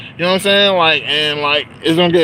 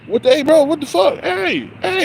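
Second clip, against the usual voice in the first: mock call-out; 11 semitones higher than usual. Calling out to cyclist in street.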